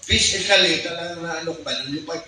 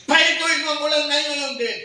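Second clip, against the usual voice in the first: higher, solemn divine command; voice of God; 10 semitones higher than usual. Retold or imitated. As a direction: voice the Lord's order, lifted high. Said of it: "pagsabi ng boses".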